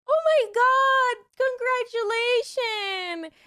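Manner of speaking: high, very slow congratulations; drugged-out delight. Retold or imitated. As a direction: gush in hammed-up woozy joy. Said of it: "me being fucking drugged out of my mind".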